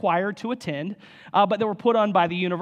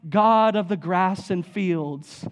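slow, hammed-up solemn prayer voice; mock a vague chapel prayer, drawn out slowly; vague lofty prayer; 3 dB louder, much slower than usual. Pastor mocks vague chapel prayers, slow and hammed-up solemn. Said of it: "or it was like".

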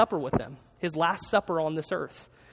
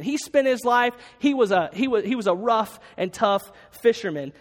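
ordinary talk

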